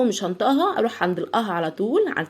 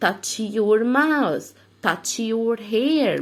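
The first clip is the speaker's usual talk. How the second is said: touch your mouth, touch your hair, very slow; slow command; much slower than usual. Shown as a demonstration. Parent playing the touch game with kids, slow commands. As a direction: lay each command out slowly. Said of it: "نقول touch your كذا".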